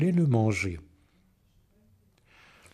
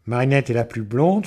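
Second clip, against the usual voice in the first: plain reading voice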